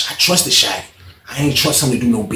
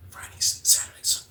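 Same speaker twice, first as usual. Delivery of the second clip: soft unvoiced whisper; whispered list; 12 dB quieter than usual. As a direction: breathe the days out under the voice. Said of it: "He was like".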